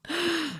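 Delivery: sharp, high intake of breath; shocked gasp. Real, not acted. Shocked reading ahead in the story.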